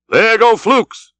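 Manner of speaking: high, slow sung-out call; cry from aloft. A narrator's reading. Lookout hailing the crew as the whale dives, high cry. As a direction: call it out long from the masthead. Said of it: "was the cry".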